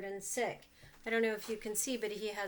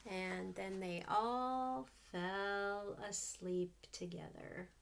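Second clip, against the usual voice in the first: slow, fading, much softer; soft story ending; 3 semitones lower, 5 dB quieter, slower than usual. A narrator's reading. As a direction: let the last words drift off to sleep. Story ending read sleepily.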